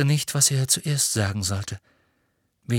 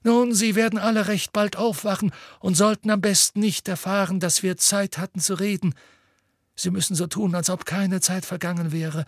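lightly voiced Dumbledore, higher pitch; Dumbledore, hushed urgency; 8 semitones higher than usual. A narrator's reading. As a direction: voice a headmaster giving instructions, lightly raised in pitch.

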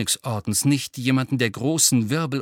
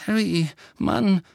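hesitant, higher-pitched voice; unsure; 8 semitones higher, 4 dB louder than usual. A narrator's reading. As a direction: feel your way uncertainly towards a friend. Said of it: "sagte Ron unsicher". (unsicher, zögernd)

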